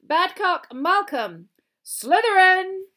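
loud, high, drawn-out announcement to a hall; calling out names. Acted. Calling new students up to be sorted, loud ringing call.

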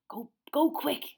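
hoarse, slow, urgent plea. Acted. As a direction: rasp it out, voice failing. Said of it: "he said hoarsely".

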